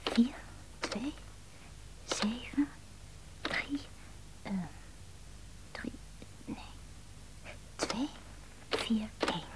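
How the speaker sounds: softly muttering the digits while dialing; half-whispered numbers, very quiet; counting the number under her breath; murmured digits. Acted.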